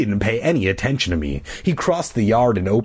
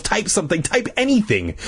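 urgent; 10 semitones higher than usual. A narrator's reading. Urging himself, Gary waking.

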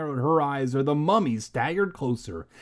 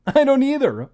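high, grinning reply; grinning joker; 14 semitones higher, 10 dB louder than usual. A narrator's reading. Uncle grinning at his own dumb joke, grinning high voice. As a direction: say it high through a grin.

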